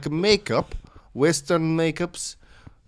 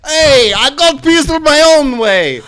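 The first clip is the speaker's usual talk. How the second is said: loud, higher barging-in yell; shouting thug; 11 semitones higher, 10 dB louder than usual. Acted. Thug slamming the door open on the couple, shouting. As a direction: burst through the door yelling.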